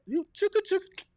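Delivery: squeaky high chugga chugga; silly high-pitched sound effect; playful pitch jump. Shown as a demonstration. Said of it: "Use vocal influx-, flex".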